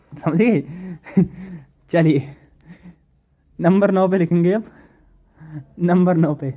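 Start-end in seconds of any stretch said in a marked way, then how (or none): none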